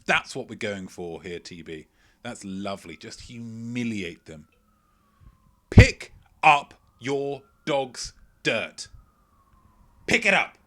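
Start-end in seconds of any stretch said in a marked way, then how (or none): 5.7-10.6 loud, slow, commanding bark, much higher; furious street telling-off; Samuel L. Jackson. Retold or imitated. Telling dog owners to pick up the mess, as Samuel L. Jackson. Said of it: "he would say, but in a, in a kind of Samuel L. Jackson".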